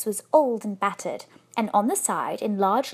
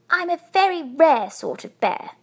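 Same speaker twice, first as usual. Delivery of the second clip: high, louder self-important little bear; 6 semitones higher, 5 dB louder than usual. A narrator's reading. Bear boasting to the wife of being rare, importantly. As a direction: puff it up with importance. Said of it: "he replied importantly".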